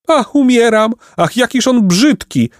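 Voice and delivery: very high, swooning exclamation; dramatic fainting maiden; maiden's cry. A narrator's reading. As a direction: cry out in theatrical horror, high-pitched. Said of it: "zawołała najstarsza z dziewic".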